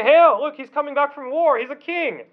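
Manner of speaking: jeering soldiers' pretend homage, pitched high; mock acclaim. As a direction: hail him in mock excitement. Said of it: "So they're mocking him".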